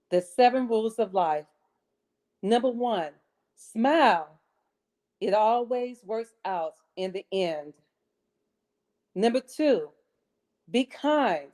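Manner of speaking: measured motivational reading with long pauses between phrases; audition recitation. Acted. Contestant auditioning with the seven rules of life, deliberate recitation. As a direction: recite each rule deliberately, lifting then falling.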